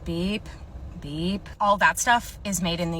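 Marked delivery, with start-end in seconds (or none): 0.1-1.5 short, soft, lower mouth beep like a barcode scanner. Acted. Clerk scanning a customer's items, mouth-made scanner beep. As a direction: imitate the register scanning items.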